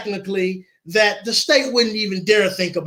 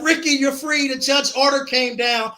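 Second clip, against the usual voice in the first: bursting with news; 5 semitones higher than usual. Retold or imitated. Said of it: "Cindy bursted out".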